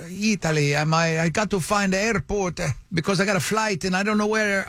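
imitated Italian-accented English. Italian traveler explaining he needs the airport, Italian accent. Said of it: "that is the accent he used".

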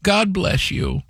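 put-on high, relieved old lady voice; old grandmother. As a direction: gush thanks like a sweet grandma. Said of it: "The grandmother says".

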